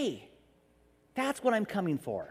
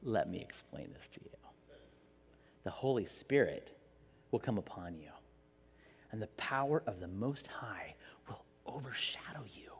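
much softer, lower, half-whispered angel; hushed angel; 12 semitones lower, 12 dB quieter than usual. Retold or imitated. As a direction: hush it like a sacred secret. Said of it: "the angel's like".

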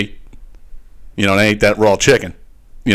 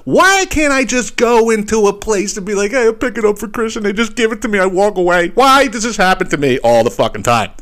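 high, wailing complaint; exasperated lament; 8 semitones higher than usual. Retold or imitated. Customer asking himself why this always happens, high exasperated lament. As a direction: whine upward at the injustice of it.

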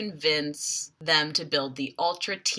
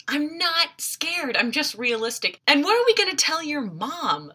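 defensive insistence; 9 semitones higher than usual. Retold or imitated. Defensive Logan quoted.

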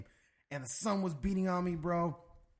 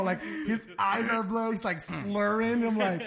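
ordinary talk, nothing marked

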